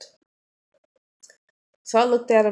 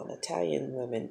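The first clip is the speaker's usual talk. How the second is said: ordinary talk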